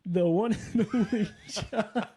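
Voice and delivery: slow, laughing announcement. Real, not acted. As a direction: announce him while cracking up. Host giggling through the introduction.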